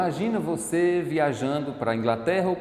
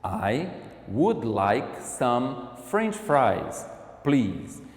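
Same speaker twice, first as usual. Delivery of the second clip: very slow, careful; much slower than usual. Shown as a demonstration. Teacher slowly repeating a fast-food order for students. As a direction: stretch "would like some French fries" out slowly. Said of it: "repeats the order slowly".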